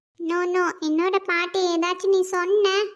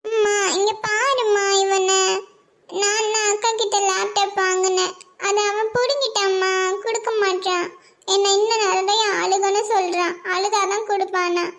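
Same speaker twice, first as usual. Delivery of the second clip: crying. Acted. Girl telling mother her brother grabbed the laptop, crying. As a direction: sob out the grievance, whining. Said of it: "எதுக்குடி அழுதுட்டு இருக்க".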